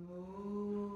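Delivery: cow moo. Shown as a demonstration. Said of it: "one more cow pose".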